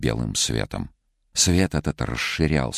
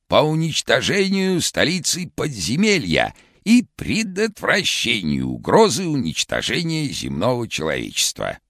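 very high, hoarse cartoon shout; vintage cartoon voice; 12 semitones higher, 3 dB louder than usual. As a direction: shout it like an old cartoon Karlsson. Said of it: "хриплым, как в старом мультике".

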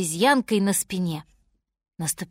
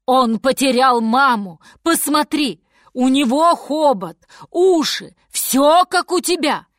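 loud, emphatic, raised voice; roaring lion; 4 semitones higher, 8 dB louder than usual. A narrator's reading. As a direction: bellow at the elephant in protest. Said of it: "тут зарычал лев".

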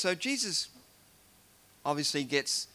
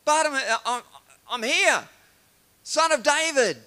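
acted high, loud shout; calling out; 11 semitones higher, 10 dB louder than usual. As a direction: yell to be heard over a noisy crowd. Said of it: "so Bartimaeus calls out".

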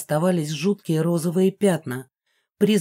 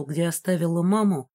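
usual speaking voice

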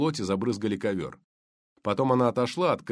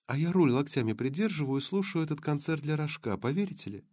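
lightly voiced blonde; 7 semitones higher than usual.

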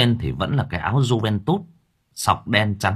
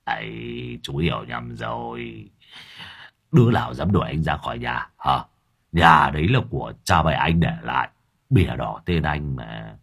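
low-voiced Lâm Thọt; 8 semitones lower than usual. A narrator's reading. Lâm Thọt pleading low with the motorbike driver. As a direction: placate him in a low murmur. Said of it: "thấp giọng".

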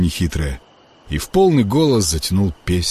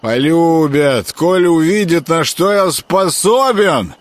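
put-on loud, slow drunken insistence; drunk slurring; 9 semitones higher, 4 dB louder, slower than usual. A narrator's reading. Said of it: "гомонил Грехвост".

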